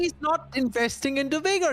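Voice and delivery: ordinary talk, nothing marked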